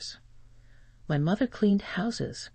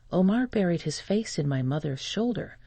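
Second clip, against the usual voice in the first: usual speaking voice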